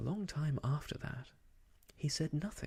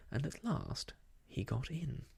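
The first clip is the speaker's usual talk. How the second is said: plain reading voice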